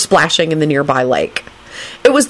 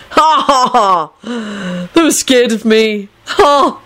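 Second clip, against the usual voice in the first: higher, mocking ha-has; snide gloating; 5 semitones higher, much slower than usual. A narrator's reading. Young man boasts they were scared, snide gloating. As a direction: gloat with separate scoffing laughs. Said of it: "Neville announced snidely".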